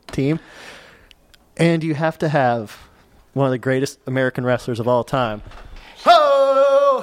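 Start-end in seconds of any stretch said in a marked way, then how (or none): 6.0-7.0 loud high "Ho!" yell; Duggan "Ho!" shout. Retold or imitated. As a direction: bark out a wrestler's "Ho!". Hacksaw Jim Duggan catchphrase.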